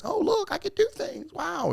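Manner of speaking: very high falsetto, dazzled and silly; mock yourself in a tiny, amazed falsetto; mock childlike wonder. Man mocking himself chasing shiny objects, squeaky falsetto wonder.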